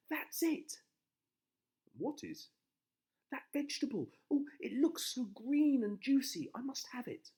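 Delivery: high, loud eager wife; excited wife voice. A narrator's reading.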